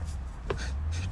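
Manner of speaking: a quiet, airless chuckle; brief hushed giggle; soft breathy laugh. Real, not acted. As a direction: laugh under your breath. Laughing at his own remark.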